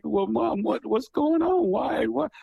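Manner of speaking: put-on child voice pressing his mother; dramatized young child. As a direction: play the worried eight-year-old asking mom. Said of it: "my dramatizations".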